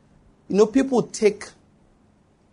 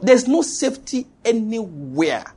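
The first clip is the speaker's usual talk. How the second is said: "There's no safety anywhere" drawn out, higher; slow emphatic repetition; 5 semitones higher, slower than usual. Real, not acted. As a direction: stretch every word out to "anywhere". Repeated slowly for emphasis.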